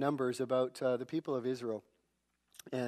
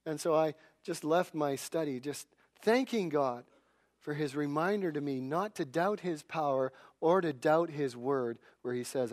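ordinary delivery

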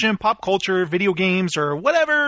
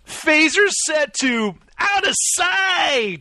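excited shout; 8 semitones higher than usual. Acted. Catchphrase shouted.

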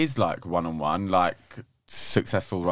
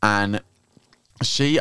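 ordinary talk, nothing marked

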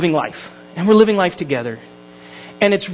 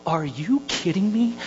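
seething under your breath at another driver; stretched-out, hushed incredulity; incredulous; 8 dB quieter, slower than usual. Retold or imitated. Retold disbelief at tailgating truck.

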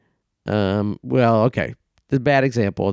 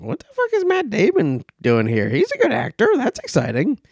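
mock surprise; 8 semitones higher than usual. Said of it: "you're like".

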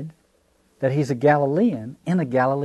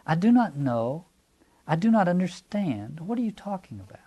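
high, puzzled and gently evasive; mild evasive denial; 6 semitones higher than usual. Acted. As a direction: feign not understanding, without pushing back. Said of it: "but see how mild that is".

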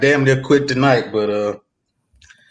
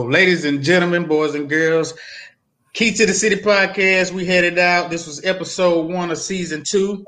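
grand entrance announcement; 6 semitones higher than usual. Acted. Podcast outro to the audience.

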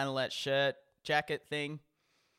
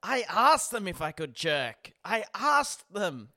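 slow, stressed "asked", louder and insistent; indignant self-defence; 5 semitones higher, 6 dB louder, slower than usual. Retold or imitated. Friend imitates comedian defending himself, slow insistent stress on "asked". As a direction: lean hard on "asked" both times, drawn out. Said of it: "He's like".

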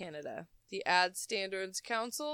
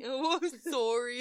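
imitating Canadian sorry; 3 semitones higher than usual. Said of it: "you say it so weird".

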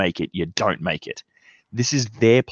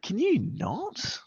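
high, soft, slow drawn-out sneer; exasperated quip; 10 dB quieter, much slower than usual. Retold or imitated. Host mocking a website's non-refundable deposit, exasperated sneer. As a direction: stretch it out in mock disbelief. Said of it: "and I was like".

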